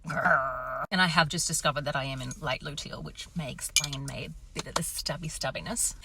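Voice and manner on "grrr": playful angry growl; wife growling at husband's comments; mock growl. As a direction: growl like an annoyed dog. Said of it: "And I was like".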